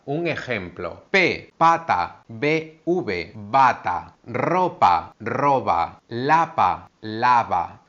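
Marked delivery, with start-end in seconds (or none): 1.1-7.8 "pata", "bata", "ropa", "roba", "lapa", "lava" said slowly, setting p against b; teacher minimal pairs p and b; p versus b contrast. Shown as a demonstration. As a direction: contrast each minimal pair carefully. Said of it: "Vamos a ver aquí una diferencia".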